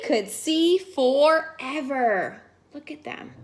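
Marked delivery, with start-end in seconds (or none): 2.7-3.3 hushed aside, barely any voice; breathy storytime whisper. Real, not acted. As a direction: whisper it like sharing a secret. Narrator aside to young listener.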